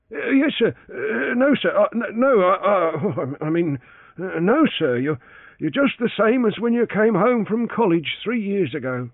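put-on high, stammering old servant with a nervous laugh; flustered valet. A narrator's reading. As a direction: trip over yes and no, embarrassed.

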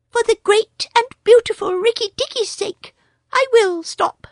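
a small proud bird boasting; Darzee the tailorbird. A narrator's reading.

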